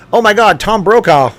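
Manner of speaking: high, loud surprised outburst; excited exclamation. Real, not acted. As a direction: gasp it out, thrilled. Excited reaction to a chat name.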